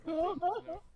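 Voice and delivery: sudden high, loud official bark; loud guard. Acted. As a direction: drown out the noise. Guard cut off, raises voice.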